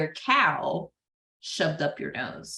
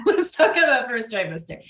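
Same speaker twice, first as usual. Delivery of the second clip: laugh, then say it louder as a proper announcement; louder laughing retake; laughing redo; 5 semitones higher, 5 dB louder than usual. Shown as a demonstration. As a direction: restart the line with a laugh and more punch.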